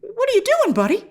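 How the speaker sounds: mock-baffled viewer; incredulous. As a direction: ask it like you can't believe your eyes. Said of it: "And you're like".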